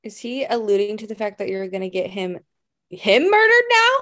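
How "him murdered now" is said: loud, high-pitched disbelief; can't-believe-it outburst; incredulous. Real, not acted. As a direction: voice leaps up loud on a shocked question. Disbelief at the texted warning.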